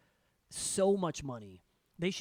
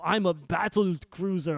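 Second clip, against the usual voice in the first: slow, louder mock German accent; 6 dB louder than usual. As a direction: ham up a German hero's accent. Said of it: "in his stupid German accent".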